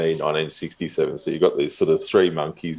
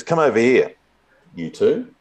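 imperious summons; 7 semitones higher than usual. Retold or imitated. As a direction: call them over like a lightly played chief. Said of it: "He summons us as the sort of, African overlord".

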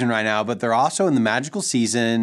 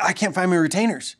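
higher-pitched imitation of a daughter; distressed daughter; 9 semitones higher, faster than usual. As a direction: voice a child's complaint, pitched up. Daughter reporting lost retainers to father.

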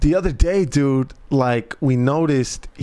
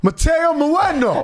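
put-on booming kid; 13 semitones higher than usual. Son answering the doctor too forcefully, imitated booming voice. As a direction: blurt the name as a boy being extra strong. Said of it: "who installed subwoofers in you".

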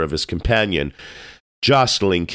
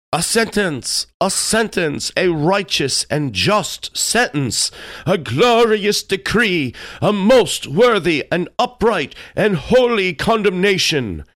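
shouted in chorus; 13 semitones higher than usual. A narrator's reading. Pest family hails the king's sentence, shouted high. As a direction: cry it out high, stretching the words. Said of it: "shouted the entire Pest family together".

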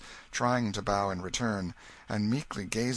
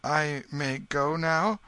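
slow, high, hesitant asking; timid question; 7 semitones higher, 4 dB louder, slower than usual. A narrator's reading. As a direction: ask leave timidly. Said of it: "turned timidly toward the king".

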